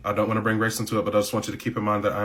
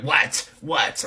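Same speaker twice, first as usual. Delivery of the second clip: sharp "What?" pitched higher, rising then falling; impatient boss; 4 semitones higher than usual. Retold or imitated. Boss at her computer snapping at trainee, pitched higher. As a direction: snap at the interruption.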